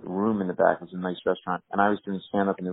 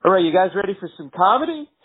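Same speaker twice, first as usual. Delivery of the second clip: loud, much higher club announcer; emcee hype; 10 semitones higher, 6 dB louder than usual. Retold or imitated. MC hyping the room after a stabbing, mock announcer. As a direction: pump up the crowd like a cheesy emcee. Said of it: "I hear the M C go".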